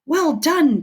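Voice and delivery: eager villagers calling out; cried out. Acted. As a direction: call out in praise. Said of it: "they cried".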